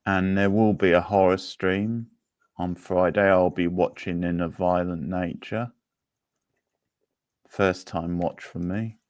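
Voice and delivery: ordinary talk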